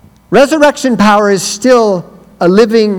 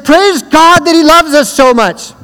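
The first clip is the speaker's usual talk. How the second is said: high, loud, slow praise; retold preaching, high and loud; rousing praise; 8 semitones higher, 3 dB louder, slower than usual. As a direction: lift the room. Retold sermon line to crowd.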